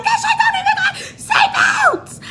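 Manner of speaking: very high, loud hammed-up shriek; dramatic panic. Passenger groping for a missing seatbelt, dramatic panicked shriek. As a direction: squeal in exaggerated alarm. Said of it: "y'all know I'm mad dramatic".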